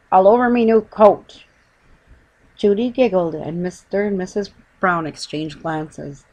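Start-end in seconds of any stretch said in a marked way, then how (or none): 0.1-1.4 loud, higher indignant grumble; bitter complaint. Acted. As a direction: complain bitterly about the ruined coat. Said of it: "he said bitterly".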